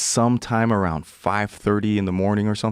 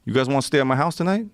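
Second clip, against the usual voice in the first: higher and quick, lightly voiced cowboy; cowboy offer; 7 semitones higher, faster than usual. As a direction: toss off the invitation brightly as the cowboy.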